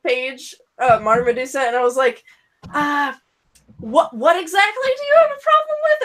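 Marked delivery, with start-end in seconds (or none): 2.7-6.0 high, fast baffled question; incredulous. Retold or imitated. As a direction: squeak it out in disbelief. Retelling own confused reply.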